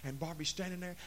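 ordinary talk

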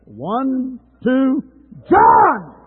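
high, loud count into a shouted name. Shown as a demonstration. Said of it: "let's go a little louder".

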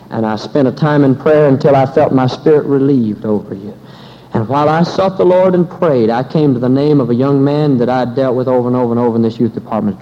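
loud, driving sermon delivery; fervent preaching. Acted. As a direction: preach it at full force to the congregation. Preacher building to full force.

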